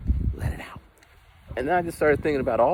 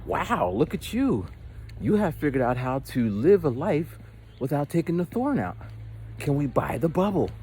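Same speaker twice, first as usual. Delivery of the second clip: mock-excited talk-show hosts, pitched higher and slowed; all excited; 5 semitones higher than usual. Talk show hosts gushing over the guest, mock excited. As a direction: gush like an awed TV host. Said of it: "they're, like, all excited".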